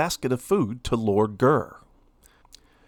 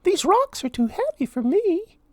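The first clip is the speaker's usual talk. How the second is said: Minnie pleading. A narrator's reading. Minnie begging Lord Gurr, high pleading mouse voice. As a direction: beg sweetly for food.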